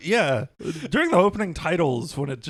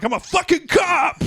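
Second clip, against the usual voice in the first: high, straining comic shout; mock outraged yell; 11 semitones higher, 3 dB louder than usual. Cop about to snap at old men, mock outraged yell. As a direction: pull rank in hammed-up outrage.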